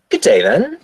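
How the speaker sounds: high, puzzled farewell, rising sharply at the end; confused. Acted. Confused man shifting over for the stranger, puzzled. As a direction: say a polite goodbye, thrown and unsure. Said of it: "kind of confusedly says as he shifts over".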